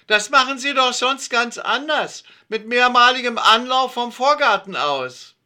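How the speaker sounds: high, loud, sneering woman's voice; snide neighbour. A narrator's reading. Frau Dörge mocking Alfred on the stairs, high and snide. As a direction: needle him with a mocking jab. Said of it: "eine spitze Bemerkung".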